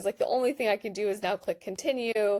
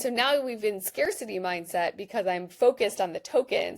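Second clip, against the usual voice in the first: normal talking voice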